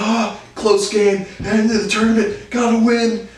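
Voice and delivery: very high, hammed-up panic; squealed mock alarm from the stands; mock parents yelling. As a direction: imitate worked-up parents in a high voice. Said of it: "they're yelling at me".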